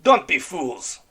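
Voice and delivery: loud, slow growled warning; slow warning growl at the crew; a stern growl, drawn out. Acted. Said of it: "Red Ram growled".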